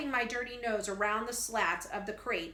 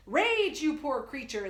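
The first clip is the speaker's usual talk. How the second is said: loud, much higher theatrical bellow; 4 semitones higher, 5 dB louder than usual. Acted. Professor bellowing at the caged pig boy, theatrical roar. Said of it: "the professor bellows".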